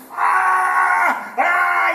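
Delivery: high, loud imitated cries of pain. Retelling the woman in the hospital, loud pained cries. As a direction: cry out like the woman doubled over in pain.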